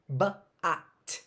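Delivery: "Ba-at" as buh, ah, t, spaced apart; just sounds. Shown as a demonstration. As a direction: sound out "Ba-at" piece by piece. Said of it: "So I'm just making sounds".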